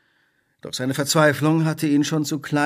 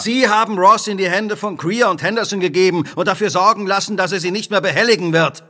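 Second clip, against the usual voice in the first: angry accusation; 8 semitones higher, 5 dB louder than usual. Acted. Holmes accuses Carstairs of murder.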